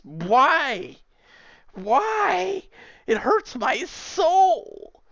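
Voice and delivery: very high, slow, hammed-up lament; mock anguish. Streamer lamenting banana pizza, hammed-up anguished whine. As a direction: whine it like it truly pains you.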